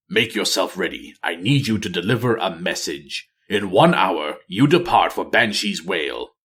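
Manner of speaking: low, cold voice giving orders; commanding dark ranger. Acted. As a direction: give the order without a shred of warmth. Nathanos gives orders.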